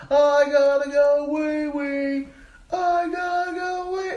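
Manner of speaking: very high, drawn-out mock scream; screaming kid. Little cousin needing the toilet on the stairs, screaming. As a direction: wail it at the top like an annoying kid. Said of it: "he's screaming at the top of".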